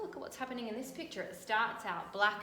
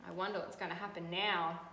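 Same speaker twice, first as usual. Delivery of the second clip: usual speaking voice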